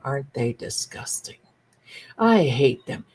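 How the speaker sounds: low, mock-disgusted grumble; lightly voiced disgusted aunts; disapproving women. Women at the table grumbling about the men, mock disgust. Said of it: "all the women would be sitting there and saying".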